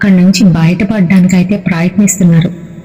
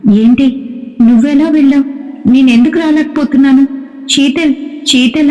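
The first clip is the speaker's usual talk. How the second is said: high, bewildered, scared questions; confused frightened pleading; 6 semitones higher than usual. Acted. Varma, stuck outside the lift, pleading with Sheetal in high alarm.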